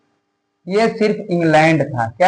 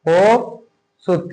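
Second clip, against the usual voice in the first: कोसुत drawn out slowly; teacher repeating a name for notes; slow dictation; 2 semitones higher, much slower than usual. Shown as a demonstration. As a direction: stretch कोसुत so students catch it. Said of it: "राजा थे कोसुत".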